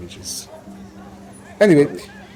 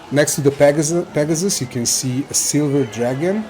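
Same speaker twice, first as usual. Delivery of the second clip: usual speaking voice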